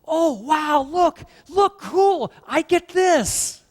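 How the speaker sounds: high, loud, gushing delight over a bone; mock delighted God. Preacher mocking a God thrilled with leftovers, loud and high. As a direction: ham up an overjoyed cry of "cool". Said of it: "sees that chicken bone and goes".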